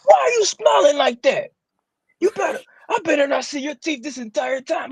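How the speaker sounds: high, strict scolding voice; mock scolding. Co-host imitating someone scolding her for smiling, high and strict. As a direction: put on a high bossy scold. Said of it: "I might show my teeth".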